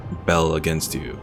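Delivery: ordinary talk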